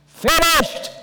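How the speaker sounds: a high, loud shout of triumph; triumphant loud cry; great voice. Acted. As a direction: cry it out like the dying shout from the cross. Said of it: "the great voice".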